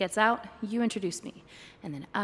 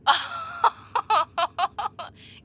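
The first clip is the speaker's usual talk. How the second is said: high, loud giddy laugh; euphoric laugh; 11 semitones higher, 7 dB louder than usual. Acted. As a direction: burst out laughing with glee. Euphoric in flight.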